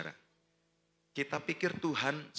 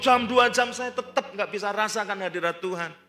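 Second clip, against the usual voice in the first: full-force preaching; 12 semitones higher, 11 dB louder than usual. Acted. Preacher teaching the congregation about surrender, preaching at full force. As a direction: drive each phrase home to the congregation.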